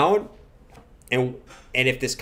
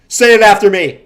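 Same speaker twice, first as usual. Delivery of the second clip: loud, high preacher-style order; hammed-up cult leader barking orders; mock cult command; 5 semitones higher, 12 dB louder than usual. Mock cult leader leading chant.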